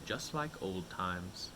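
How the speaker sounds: low, soft and slow; slow, soft nostalgic musing; wistful thought. Acted. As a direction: muse it quietly to yourself. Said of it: "thought to himself".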